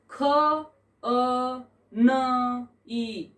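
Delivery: very slow, each letter pulled apart; spelled out letter by letter. Shown as a demonstration. Teacher spelling a Sanskrit word for the class, letter by letter, very slowly. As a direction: lay out the letters one at a time with gaps. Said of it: "वर्ण संयोजनम कृत्वा".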